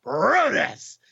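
high, ringing ring announcer. Retold or imitated. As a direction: stretch the name out for the crowd. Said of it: "did his best announcer voice".

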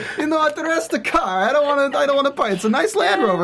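stalling thug, Wiseau-flavoured; Tommy Wiseau impression. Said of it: "There's a little bit of Tommy Wiseau in that".

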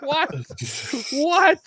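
mock shock. Told they are all getting fired, high stunned disbelief. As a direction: squeak it out in shock. Reacting to firing news, laughing.

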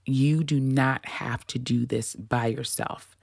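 slow, deliberate reassurance. Shown as a demonstration. Host reassuring listeners, slow deliberate take. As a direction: lay each word down slowly. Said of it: "let me slow down".

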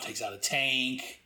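ordinary delivery

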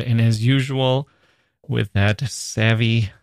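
ordinary talk, nothing marked

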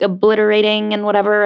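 ordinary talk, nothing marked

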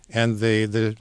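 ordinary delivery

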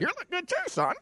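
very high eerie voice; creepy high voice. Pretend. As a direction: go very high and strange. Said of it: "the other one's creeping me out".

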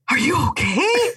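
gasp, then high worried are you okay; a quick startled gasp then concern; imitating gasping sister. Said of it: "she goes".

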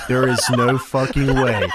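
slow, laughing disbelief; stunned disbelief. Retold or imitated. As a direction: draw it out in shock. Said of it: "and I said".

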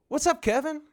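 high-pitched, hailing hello; retold friendly greeting. Storyteller greets supposed friend Kevin, high retold hail. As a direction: pitch it way up as you hail an acquaintance.

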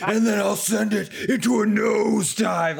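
hammed-up Warrior ranting; mock wrestler threatening from a plane; Ultimate Warrior threat. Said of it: "he goes".

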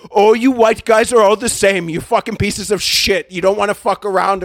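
loud, high mock tirade; imitated angry freak-out. Rejected caller leaving a direct message, imitated furious rant. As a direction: rant at full volume as the outraged caller. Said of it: "he had a freak out".

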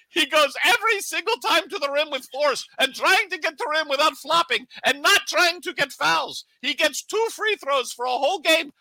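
a hammed-up Serbian accent, outraged at the refs; coach's Serbian-accented rant about free throws; imitating Darko's Serbian accent. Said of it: "I tried to do his accent".

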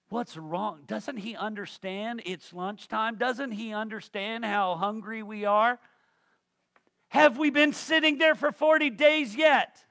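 building to an indignant yell; hungry churchgoer losing patience; mock complaint. Voicing hungry congregation's complaint.

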